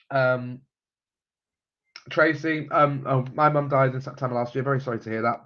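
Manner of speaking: ordinary talk, nothing marked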